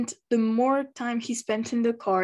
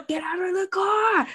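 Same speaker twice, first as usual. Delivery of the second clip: higher, urgent shout; near scream; 10 semitones higher, 3 dB louder than usual. Retold or imitated. Fan yelling at the driver in the burning car, near scream. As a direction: yell it at the screen, panicking. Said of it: "I was nearly screaming".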